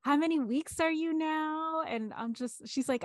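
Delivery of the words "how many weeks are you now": high, slow, gushing nurse voice; chirpy sonographer. Retold or imitated. Imitating the sonographer asking a grieving mother, gushing high. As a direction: mimic the clueless upbeat sonographer.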